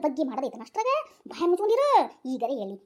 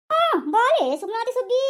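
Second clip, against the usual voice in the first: drawn-out greeting; 6 semitones higher, 5 dB louder, slower than usual. Acted. Neighbour greeting in new voice.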